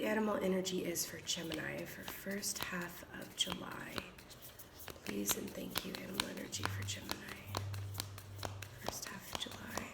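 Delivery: hushed, mostly unvoiced murmur; whispered invocation. Real, not acted. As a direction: whisper it softly like a private request. Murmured invocation before drawing a card.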